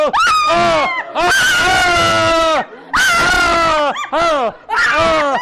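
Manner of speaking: very high, loud drawn-out "oh" cries; ecstatic screams. Real, not acted. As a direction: shriek "oh" in bursts at the peak of prayer. Cries out at climax of prayer.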